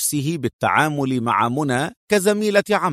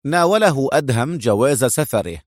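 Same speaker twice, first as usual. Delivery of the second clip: ordinary delivery, nothing marked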